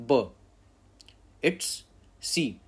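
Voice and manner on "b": teacher sounding out a letter; Russian letter sound. Shown as a demonstration. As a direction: say B as a clear, firm "beh". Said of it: "then we have to pronounce it".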